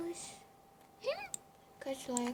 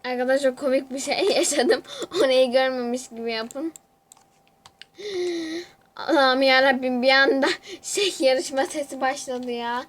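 louder, eager telling of a funny moment; loud excited report; 8 dB louder than usual. Real, not acted. Streamer to viewers, relieved the sound works, loud and excited. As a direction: tell it loudly with relief.

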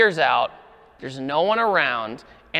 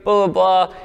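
lightly higher, nervous yes-sir pleading; mock stiff apology; 5 semitones higher than usual. Friend pulled over, groveling to the officer in a lightly mocking voice. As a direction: imitate a friend groveling to a cop. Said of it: "all stiff and just like".

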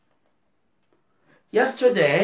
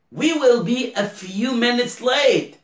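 acted quote; 7 semitones higher, slower than usual. Teacher acts out example quote.

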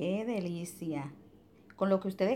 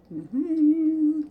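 excited; 9 semitones higher, 8 dB louder than usual. Real, not acted. Delighted exclamation.

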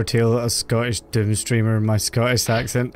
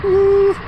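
loud high woo; 7 dB louder than usual. Real, not acted. Cheering a game moment.

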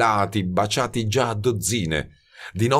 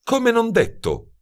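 evasive, higher and slow; a quick evasive retreat, pitched up; slower than usual. A narrator's reading. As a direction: brush it off lightly, dodging the question. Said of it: "rispose evasiva".

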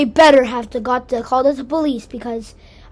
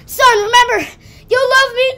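tender farewell; 8 semitones higher than usual. Pretend. Parent toy farewell voice.

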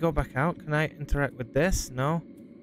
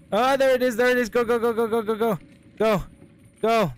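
scared horror game streamer; 7 semitones higher, 4 dB louder than usual. Real, not acted. Fleeing monster in game.

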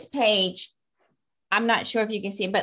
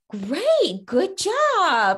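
mock teacher praise; 10 semitones higher, 5 dB louder, much slower than usual. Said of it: "the teacher's like".

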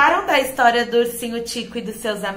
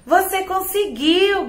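high, slow cheering call; 5 semitones higher, slower than usual. A narrator's reading. Little rabbit cheers Tico's rescue, high and excited. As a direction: cheer him on proudly. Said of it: "gritou a coelhinha".

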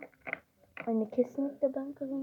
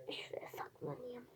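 whisper; 3 semitones lower, 20 dB quieter than usual. Pretend. Hiding spot, repeated in secret.

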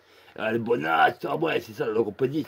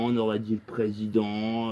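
usual speaking voice

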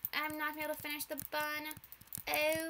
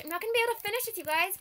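panicked wail; 6 semitones higher, 6 dB louder, much faster than usual. Real, not acted. Panicking at the timer running out.

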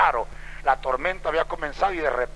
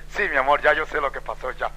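lower, settled husband's reply; calm reassurance; 4 semitones lower than usual. Retold or imitated. Husband answering his excited wife, calm and knowing.

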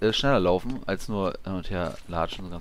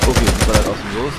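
loud, hyped-up gamer callout; loud excited; 10 dB louder than usual. Real, not acted. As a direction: call it out over the gunfire. Enemy firing during fight.

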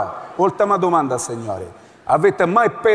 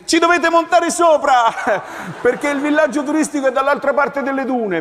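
chirpy rep; 8 semitones higher than usual. Acted. Resort rep cheerful.

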